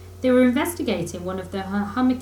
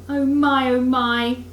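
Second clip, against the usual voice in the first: higher, louder pained moan; 3 semitones higher, 5 dB louder, slower than usual. A narrator's reading. Mole moaning to Rat about his hurt leg. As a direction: groan over the sore shin.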